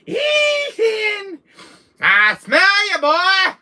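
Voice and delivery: put-on high, loud Louisiana-accented villain call; Jack's voice. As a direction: shout it like the stalking Jack in a Louisiana accent. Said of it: "I can do Jack's voice so good".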